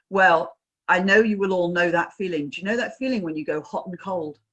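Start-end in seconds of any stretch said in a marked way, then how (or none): none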